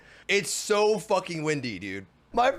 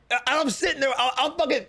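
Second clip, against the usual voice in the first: ordinary talk, nothing marked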